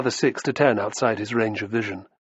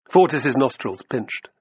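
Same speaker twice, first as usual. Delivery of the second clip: ordinary delivery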